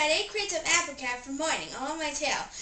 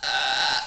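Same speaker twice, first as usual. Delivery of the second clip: loud burp; 12 semitones lower, 6 dB louder than usual. Acted. Burping contest, burps.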